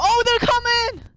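high panicked warning; alarmed shout. Real, not acted. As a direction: yelp as they charge. Zombies rushing at him.

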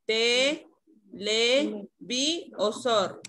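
"Te, le, vi o sor" slowly, each apart; syllables offered separately. Shown as a demonstration. Teacher offering Rodrigo choices, "Te, le, vi o sor" syllable by syllable. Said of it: "¿cuál es la sílaba tónica?".

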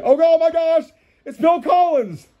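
high-pitched, hammed-up amazement; excited astonishment. Host spots a singer in the cartoon, high mock astonishment. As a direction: exclaim like a starstruck fan.

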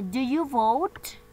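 "Do you vote?" drawn out slowly, rising at the end; slow modeled question. Shown as a demonstration. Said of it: "la pregunta dice". (pregunta lenta con subida final)

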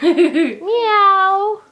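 high loud laughing meow. Pretend. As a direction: meow like a kitten. Cat sound with laugh.

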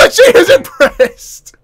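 high, loud, breaking into laughter; slow, helpless laughing squeal. Real, not acted. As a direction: squeeze the words out through laughter. Cracks up at her reaction.

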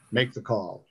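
soft, understated command; quiet captor giving an order; oozing villain. A narrator's reading. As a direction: order it softly, in no hurry. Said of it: "oozed over the room like rancid honey".

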